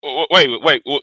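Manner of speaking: ordinary talk, nothing marked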